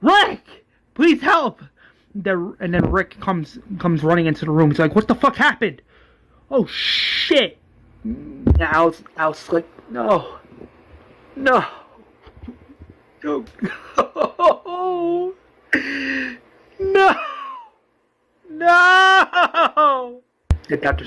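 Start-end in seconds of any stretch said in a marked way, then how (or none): 0.0-1.6 high, loud cries for help; acted frantic high-pitched yelling; scream. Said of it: "Alice screams". 4.9-7.6 high-pitched alarmed outburst; higher, horrified exclamation; acted shock. Rick runs in on the attack. 9.9-20.2 drawn-out grief-stricken sobbing cries; acted anguish. Alice grieves over bitten doctor.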